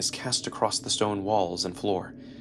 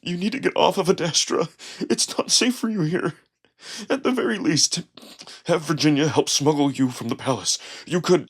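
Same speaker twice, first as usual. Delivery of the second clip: urgent tearful plea; 5 semitones higher, 4 dB louder than usual. A narrator's reading. Wolf pleading tearfully.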